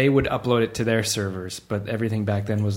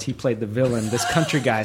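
ordinary talk, nothing marked

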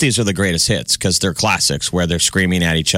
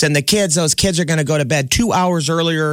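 higher bossy announcement; mock strict new mom; 8 semitones higher than usual. New mom announcing rules to the kids, higher bossy voice. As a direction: lay down the bedtime rule, lightly voiced.

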